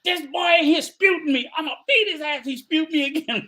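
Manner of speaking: high, mock-angry grandma; grandma complaining, threatening a beating; hammed-up scolding grandma voice; imitating angry grandmother. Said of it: "And she'd be like".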